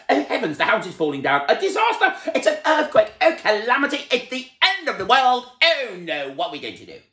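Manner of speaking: high, loud panicked wailing; panicking. A narrator's reading. Mister Jelly sure his house is falling down, loud panicked wail. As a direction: wail as if the world is ending.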